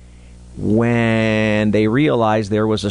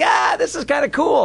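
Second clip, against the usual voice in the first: imitating excited kid. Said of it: "for him, it was all a game".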